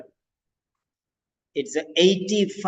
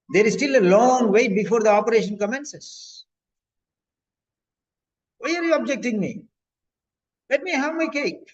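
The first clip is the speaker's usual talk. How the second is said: a stubborn patient who wants cake, much higher and indignant; angry patient; 6 semitones higher than usual. Acted. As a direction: plead and protest like a spoiled patient denied dessert. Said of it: "He's very angry, he's annoyed, he's reluctant".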